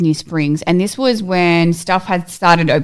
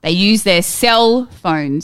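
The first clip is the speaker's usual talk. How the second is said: slow deadpan punchline; embarrassing dad joke; much slower than usual. Acted. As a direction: drag out the punchline slowly. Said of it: "classic dad joke".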